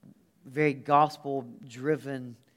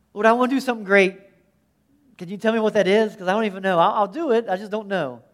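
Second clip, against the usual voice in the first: loud, fast, pleading prayer; a rushed, eager cry to the Lord; urgent prayer; 4 semitones higher, 9 dB louder, faster than usual. Retold or imitated. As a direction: blurt it out to God in a rush. Voiced earnest prayer, loud and fast.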